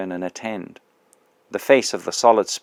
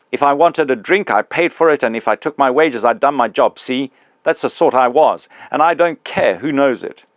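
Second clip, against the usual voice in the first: higher, louder aggrieved grumbling; a working man with a grievance, loud and higher; the big ghost confronting his old employee, loud and aggrieved; indignant big ghost; 5 semitones higher, 5 dB louder than usual. Acted.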